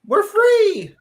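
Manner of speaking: loud, high mock celebration; gleeful cheer. Cohost celebrating the host vanishing, gleeful high shout. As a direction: shout it out as if just released.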